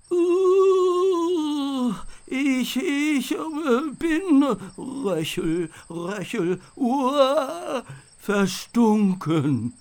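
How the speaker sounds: the knight, knocked flat by skunk spray, croaks and gasps from the floor; croaking, stinking knight. A narrator's reading. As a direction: choke out the words, overcome by the stench. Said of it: "krächzte er am Boden liegend".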